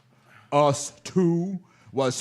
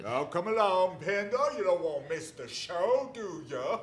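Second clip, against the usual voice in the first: taunting; 9 semitones higher, 7 dB quieter than usual. Acted. Mocking Pendle before the hanging.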